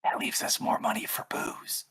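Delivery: slow, unvoiced-breath whisper; hushed, drawn-out stage whisper; conspiratorial whisper. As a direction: whisper it slowly like a secret. Player's aside about money for booze.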